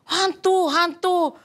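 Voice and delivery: high startled cry of the disciples; frightened cry. Acted. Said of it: "mereka terkejut dan berseru". (teriakan ketakutan bernada tinggi)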